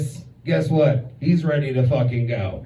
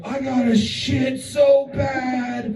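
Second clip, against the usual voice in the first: high, slow strained wail; high drawn-out pained cry; desperate whine; 9 semitones higher, slower than usual. Retold or imitated. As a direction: wail it like a kid about to burst. Son doubled over, desperate.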